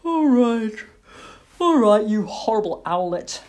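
groaning, loud weary surrender; roused sleeper grumbling at his child; grudging father owl. A narrator's reading. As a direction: give in with a heavy, irritated sigh. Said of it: "Mister Barn Owl sighed and stretched".

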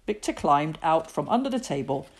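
ordinary delivery, nothing marked

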